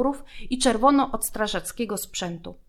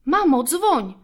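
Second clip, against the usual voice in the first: urgent plea; 7 semitones higher, 7 dB louder, slower than usual. A narrator's reading.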